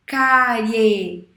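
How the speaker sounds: Cárie loud, with the final E standing out; demo word. Shown as a demonstration. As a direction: say Cárie so the E dominates. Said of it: "Você escuta mais o E".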